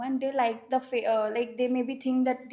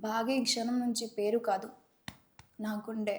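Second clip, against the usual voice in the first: fast, softer, grave movie line; serious mode; 2 semitones lower, 7 dB quieter, much faster than usual. Shown as a demonstration. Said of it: "In serious mode".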